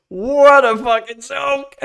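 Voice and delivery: slow, loud outburst with laughter; loud laughing exclamation. Real, not acted. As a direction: draw it out loudly through a laugh.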